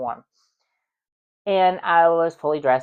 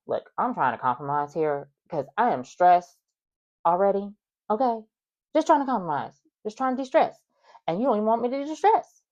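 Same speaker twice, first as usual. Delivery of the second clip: exasperated pleading; 6 semitones higher than usual. Retold or imitated. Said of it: "I was like".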